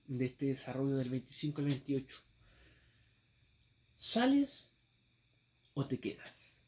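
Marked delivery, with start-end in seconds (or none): 4.0-6.6 ¿Sales? cried out high, then o te quedas? falling away; sharp rising question. Shown as a demonstration. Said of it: "Ese fue el subtema".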